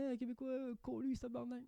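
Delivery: French person imitating a Québécois; a light French take on Québécois slang; mock Québécois; mock Québécois accent. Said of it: "les Français aiment utiliser l'accent québécois".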